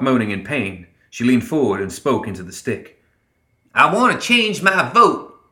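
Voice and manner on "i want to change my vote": high, slow character voice; high-pitched, drawn-out demand; stubborn Ma, pitched well up; Ma Tucket, brash and insistent. A narrator's reading.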